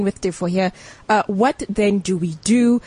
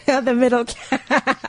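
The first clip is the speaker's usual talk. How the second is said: slow words broken by laughter; laughing; 4 semitones higher, much slower than usual. Real, not acted. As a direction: laugh through the phrase. Host laughs at the phrase.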